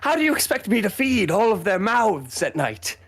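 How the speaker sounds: high, put-upon peasant mother; a mother griping about hungry mouths; NPC mother voice. Acted.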